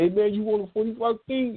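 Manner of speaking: very high-pitched imitation; high voice, stretched-out question; put-on caller voice. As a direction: mimic a man asking, way up high. Imitates forty-five King asking.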